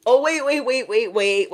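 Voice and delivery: ordinary talk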